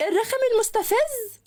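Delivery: high blurted shout; excited outburst. A narrator's reading. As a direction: blurt it out without thinking. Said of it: "صرخت البنت". (صرخة عالية من غير قصد)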